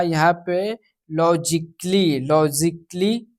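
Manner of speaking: लॉजिकली spoken slowly, silent a, ending lo-ji-klee; drawn-out model word लॉजिकली; slow teaching demo. Shown as a demonstration. Teacher models silent A in लॉजिकली, slowly. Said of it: "ए जो है silent रहेगा".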